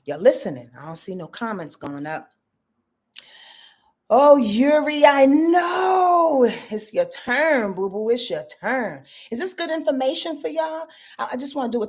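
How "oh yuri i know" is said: excited shout. Real, not acted. Reacting to viewer Yuri.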